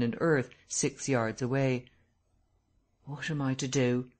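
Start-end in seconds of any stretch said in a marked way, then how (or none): 3.0-4.1 subdued, sullen question; sullenly. A narrator's reading. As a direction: ask it sulkily, without pushing. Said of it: "he said sullenly".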